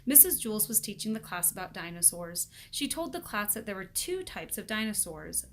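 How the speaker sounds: ordinary delivery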